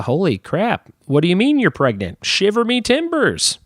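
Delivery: high, startled disbelief; mock shock. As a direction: act shocked at the news, pitch jumping up. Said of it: "expression to show shock or disbelief".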